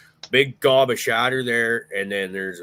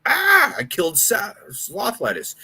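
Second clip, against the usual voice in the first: high, louder panicked yelp; mock panic; 12 semitones higher, 5 dB louder than usual. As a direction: act out the sudden fear you killed him. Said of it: "And I'm just like".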